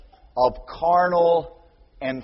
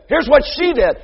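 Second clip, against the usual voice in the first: louder, higher tattle; tattling loudly; 9 semitones higher, 9 dB louder than usual. Retold or imitated. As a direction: point the finger at sister. Child blaming sibling.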